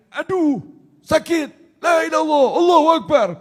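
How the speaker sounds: high-pitched mock yelping; acted pain cry. Pinched [name] yelling in pain, acted.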